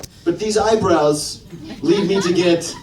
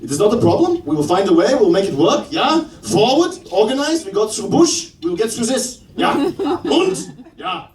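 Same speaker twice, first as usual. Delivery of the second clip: put-on brisk German accent, pitched up, rising ends; rally them in short bursts with a German accent; 6 semitones higher than usual. Comedian as Germans organizing to get through this, German accent. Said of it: "The Germans".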